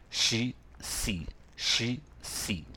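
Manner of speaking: "シー、シー" slowly alternating rounded-lip shee and hissed see; SHE versus SEE. Shown as a demonstration. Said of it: "唇をとんがらせますね".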